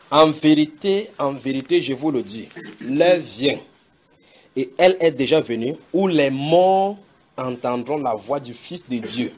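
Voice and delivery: slow, loud solemn recitation of the verse; preaching scripture. Acted. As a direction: proclaim the verse slowly to the congregation.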